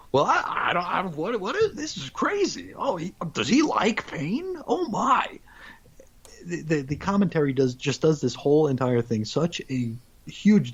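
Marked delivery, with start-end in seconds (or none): 0.1-5.4 high, breathy-free mock fluster, pitched way up; bewildered commentator impression. Commentator fumbling over a weird match, in a high flustered voice. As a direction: play a baffled announcer squeaking in confusion. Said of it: "they're just like".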